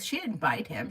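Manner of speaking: ordinary delivery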